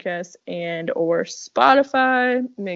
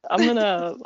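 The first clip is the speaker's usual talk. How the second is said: ordinary talk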